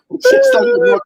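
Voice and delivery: squealing with high laughter; laughing. Real, not acted. Friend laughs at the finger on the microphone. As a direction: shriek it out through laughter.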